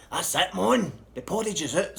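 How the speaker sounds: loud impatient summons; calling out. Retold or imitated. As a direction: rouse him out of bed. Calling son to breakfast.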